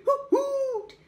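high, loud hoot like an ape. Shown as a demonstration. Ape hooting demonstration.

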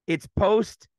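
ordinary talk, nothing marked

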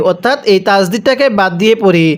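normal talking voice

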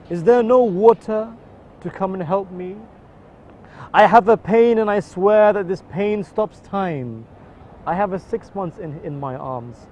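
spoken-word mourning poem in the voice of Imam Hussein; grieving poem recitation. Acted. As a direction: recite a lament for Karbala with mounting grief.

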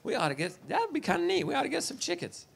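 a hint of relatives musing at a party; casual family chatter. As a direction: toss the idea off half-heartedly. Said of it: "And everybody's like".

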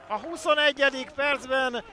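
roaring goal shout, high and loud; excited hungarian sports commentary. Real, not acted. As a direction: scream the goal, then draw it out.

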